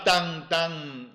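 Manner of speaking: ordinary delivery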